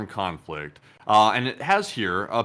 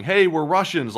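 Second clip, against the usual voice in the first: mock shout, higher; imitated yell; 7 semitones higher, 4 dB louder than usual. Ukrainians yelling over the hill, mock yell. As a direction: call out the fake greeting. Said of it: "started yelling".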